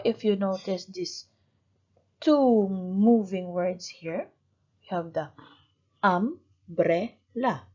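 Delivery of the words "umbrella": "umbrella" slowly as um-bre-lla, pauses between; syllable by syllable. Shown as a demonstration. Teacher asks how many syllables, "umbrella" slowly split in three. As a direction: cut "umbrella" into three separate beats. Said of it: "How many syllables? Three".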